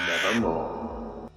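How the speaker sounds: dark, drawn-out raven croak, held back; the raven answers slowly, softly, from the gloom; low, soft, slow raven voice. Acted. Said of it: "Quoth the raven".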